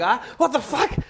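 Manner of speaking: high, startled outburst with a laugh. Retold or imitated. Woken by his wife's scream, high startled outburst. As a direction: jolt awake in alarm. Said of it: "I'm like".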